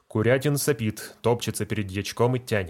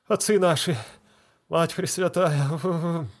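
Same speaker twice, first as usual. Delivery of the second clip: pained prayer; 7 semitones higher than usual. Acted. Deacon in pain, tongs in mouth.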